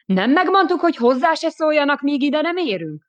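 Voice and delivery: angry officer snapping at the lieutenant. Acted. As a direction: snap at the lieutenant in anger. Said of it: "Will felcsattant".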